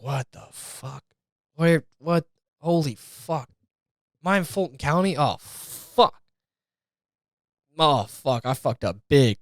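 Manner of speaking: quiet, dazed, half-mumbled panic; just woke up in a cell, confused; groggy hungover guy. Pretend. Hungover inmate wakes, panicked.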